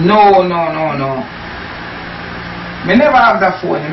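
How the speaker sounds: ordinary talk, nothing marked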